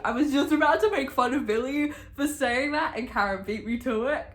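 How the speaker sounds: high, loud, delighted rush of words; excited gushing. Real, not acted. Reader gushing to viewers about Karen, excited. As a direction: burst out with fan excitement. Gushing over a book character.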